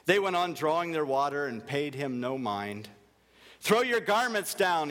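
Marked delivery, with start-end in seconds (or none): 3.6-4.9 sharp, raised, cross demand; an indignant donkey barking an order; higher, louder cross command; said crossly. A narrator's reading. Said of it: "he said crossly".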